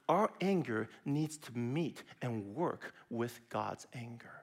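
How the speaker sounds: slow and soft, fading to a whisper on anger; slow down and let the last word vanish; reverent, fading hush. Real, not acted. Sermon key point.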